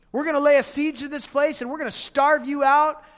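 shouted threat, high and loud; retold scream. Envoy screaming threats at the people on the wall. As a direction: yell it so the whole wall hears. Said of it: "he starts screaming so they can all hear it".

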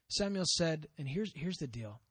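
ordinary talk, nothing marked

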